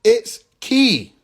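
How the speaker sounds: Ki said as a short "kee"; correct pronunciation. Shown as a demonstration. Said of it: "get the pronunciation right".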